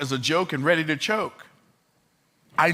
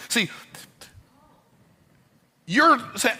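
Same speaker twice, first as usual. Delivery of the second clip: ordinary talk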